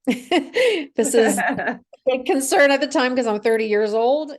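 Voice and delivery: real amused laugh in the voice. Real, not acted. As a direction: laugh while getting the words out. Laughs at her own worry.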